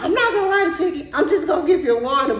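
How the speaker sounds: fast, high-pitched imitation; worked-up officer. Officer letting the driver off with a warning, fast and high. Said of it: "he was on ten the whole time".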